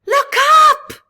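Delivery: high, loud shout of warning. A narrator's reading. Crab calling the reef to look up, shouting. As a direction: yell it up to the others. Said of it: "Crystal shouted".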